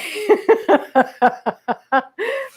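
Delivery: a high rising laugh of delight. Real, not acted. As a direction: laugh with glee at the dog. Dog joins the class.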